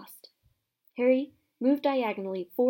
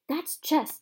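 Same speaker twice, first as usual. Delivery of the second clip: higher, curt retort; sharp snap; 7 semitones higher, 3 dB louder than usual. Acted. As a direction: cut them off sharply. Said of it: "snapped Ron".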